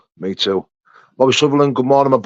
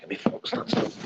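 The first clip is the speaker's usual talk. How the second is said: whispered to himself; 12 dB quieter than usual. Real, not acted. Mutters to himself before starting.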